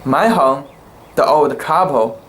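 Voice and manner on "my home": ordinary delivery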